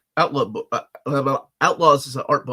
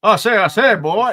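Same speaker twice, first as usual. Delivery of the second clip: loud, higher-pitched blustering cartoon rooster; Foghorn Leghorn; 6 semitones higher, 8 dB louder than usual. Host showing a co-host a twang, loud cartoon rooster impression. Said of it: "that's Foghorn Leghorn".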